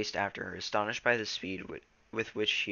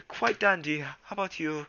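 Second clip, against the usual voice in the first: high hammed-up British accent; posh British accent; 7 semitones higher, 5 dB louder than usual. Shown as a demonstration. Reader showing Twolegs' British voice, high mock British. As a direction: play a prim Englishman. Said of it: "they're gonna be British".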